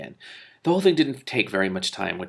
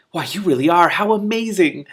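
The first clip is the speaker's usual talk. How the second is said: higher, louder astonished lizard; amazed; 8 semitones higher, 6 dB louder than usual. Acted. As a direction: marvel at it, squeaky.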